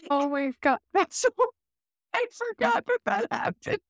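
ordinary delivery

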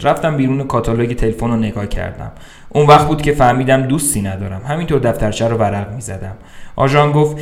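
ordinary delivery, nothing marked

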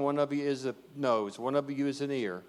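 ordinary talk

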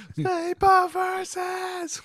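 slow, high, drawn-out announcer shout. Pretend. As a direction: boom it like a fight announcer. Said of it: "I'm glad that you pointed it out".

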